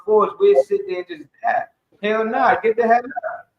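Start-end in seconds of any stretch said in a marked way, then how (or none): none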